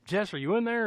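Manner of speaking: imitated searching call; mock yell. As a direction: call for someone at half volume. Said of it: "yells, uh".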